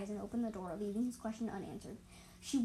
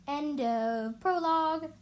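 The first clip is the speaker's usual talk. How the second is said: dramatic announcement; 5 semitones higher, 8 dB louder, much slower than usual. A narrator's reading. Dramatic chapter ending.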